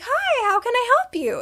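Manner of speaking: very high, sing-song courteous voice; polite greeting. Retold or imitated. Mother greeting the staff at her car window, high-pitched politeness.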